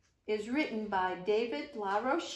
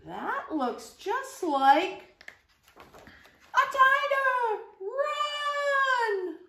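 being chased; 13 semitones higher, 5 dB louder, much slower than usual. Acted. Tiger appears, fleeing.

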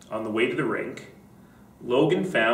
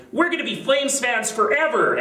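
put-on excited kid; 12 semitones higher than usual. A narrator's reading. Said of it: "added Liam".

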